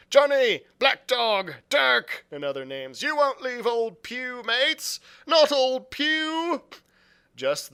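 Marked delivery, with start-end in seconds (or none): 0.1-2.2 loud, high desperate calls; calling out. A narrator's reading. Blind Pew calling for his deserting comrades, loud. 3.0-6.6 loud, high, slow begging wail; desperate pleading. A narrator's reading. Blind Pew begging his mates not to leave, high.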